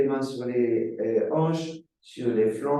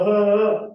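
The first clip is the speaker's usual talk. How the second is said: high, loud sung open ah; vocal sigh on an open ah; sung release sigh; 10 semitones higher, 9 dB louder than usual. Shown as a demonstration. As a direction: let a big sung ah out as you release. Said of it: "et je relâche encore".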